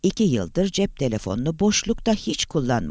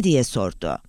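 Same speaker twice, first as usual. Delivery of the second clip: ordinary delivery, nothing marked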